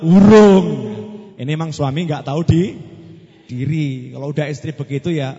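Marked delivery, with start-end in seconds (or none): 0.0-0.7 blunt, much louder grunt of a word; curt loud answer. Acted. Lazy husband answering his wife, curt loud reply. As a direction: snap it back rudely.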